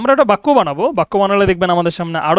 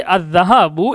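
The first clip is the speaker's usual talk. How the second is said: slow demonstration; slower than usual. Shown as a demonstration. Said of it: "এই শব্দটা হচ্ছে কি".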